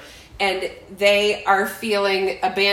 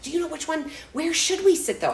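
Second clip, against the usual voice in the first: higher, light guests' voice; eager newcomers; 7 semitones higher than usual. Retold or imitated. Imitating guests asking where to sit, higher voice. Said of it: "They're like".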